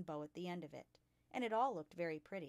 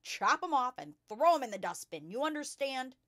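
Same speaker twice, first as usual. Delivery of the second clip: very loud, higher-pitched bark; 6 semitones higher, 11 dB louder than usual. A narrator's reading. Headmistress threatens a pupil over her hair, barking. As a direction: bark the order, demanding obedience. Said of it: "she barked".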